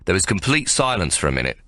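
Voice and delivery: ordinary delivery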